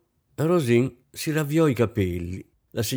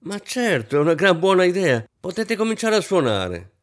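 much higher, eager call to the musicians; 8 semitones higher, 4 dB louder than usual. A narrator's reading. Hostess turns to the orchestra to start playing, calling out eagerly. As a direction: call out brightly across the room. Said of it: "gridò la signora Kampf".

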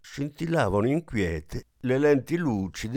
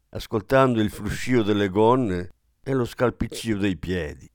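ordinary delivery, nothing marked

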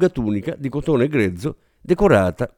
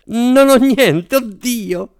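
much higher, slow despair; panicked wail; 10 semitones higher, 4 dB louder, much slower than usual. Acted. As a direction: cry out, lost. Panic continues after shriek.

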